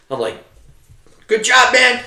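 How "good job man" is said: hearty praise. Retold or imitated. Praising soldier loudly.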